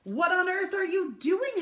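high, loud cry of alarm; mother bursting out at the sight; shocked cry. Acted. Mother catching Liza colouring the wall, high loud cry. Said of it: "her mother cried".

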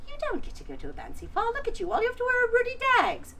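high, fast and sneering; mean stepmother. Acted. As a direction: scorn her quickly in a shrill voice. Stepmother scolds Rindersella.

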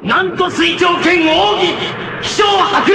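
high, full shouted attack name; battle cry. Acted. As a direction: roar the secret move as you leap. Shouting secret technique name.

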